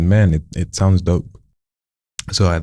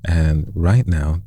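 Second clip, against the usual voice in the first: slow and drawn out; much slower than usual. Shown as a demonstration. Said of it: "coach shows slow phrase".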